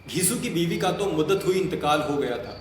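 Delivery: ordinary talk, nothing marked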